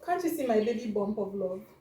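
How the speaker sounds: usual speaking voice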